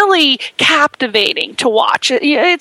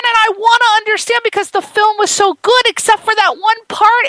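mock upset; 8 semitones higher than usual. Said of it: "It disturbed me".

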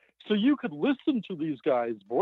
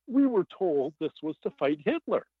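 higher-pitched quoted protest; impassioned questioning; 8 semitones higher than usual. Retold or imitated. Author quoting a scientist who doubts the project, impassioned questioning. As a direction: press the questions in a raised, pleading pitch.

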